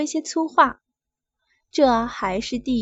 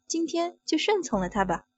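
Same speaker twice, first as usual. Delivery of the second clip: plain reading voice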